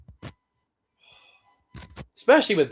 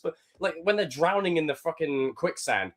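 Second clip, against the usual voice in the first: ordinary talk, nothing marked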